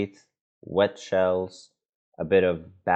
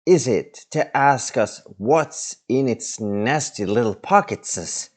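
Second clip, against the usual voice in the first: Gollum; 5 semitones higher, 4 dB louder than usual. Acted. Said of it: "Gollum speaking".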